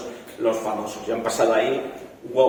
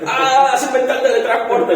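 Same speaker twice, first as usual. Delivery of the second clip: much higher, louder, laughing joy; giddy excitement; 12 semitones higher, 5 dB louder than usual. Retold or imitated. Young man telling parents about film, in mock thrilled delight. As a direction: squeal it like a thrilled inventor, laughing. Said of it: "en mi corto cuando el tío empieza a saltar".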